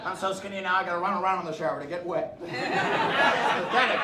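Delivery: ordinary delivery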